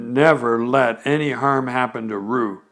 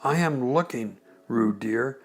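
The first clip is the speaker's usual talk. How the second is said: quiet, firm motherly reproach; rather severe; 6 dB quieter than usual. Acted. Kanga reproaching Piglet as Roo, quiet and firm. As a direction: scold gently but firmly. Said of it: "said Kanga rather severely".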